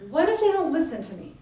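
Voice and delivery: fretting Moses; worried Moses. Acted. Moses worrying.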